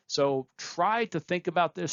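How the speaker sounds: ordinary talk, nothing marked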